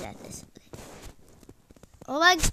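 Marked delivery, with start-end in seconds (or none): 2.1-2.5 loud, high exasperated yell; really loud outburst. Real, not acted. Gamer dying in the game, loud exasperated scream. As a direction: let it erupt into a long scream. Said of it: "that was really loud".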